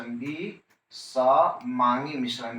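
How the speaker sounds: विसमांगी मिश्रण stretched out very slowly; drawn out slowly. Shown as a demonstration. Teacher repeating विसमांगी मिश्रण slowly for notes. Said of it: "इधर क्या बोलने का है".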